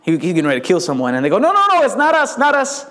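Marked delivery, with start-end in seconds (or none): 1.4-2.9 high-pitched frantic protest; mock-terrified servants; panicked denial. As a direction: plead innocence in a high, hurried voice. Frightened servants deny being the rat.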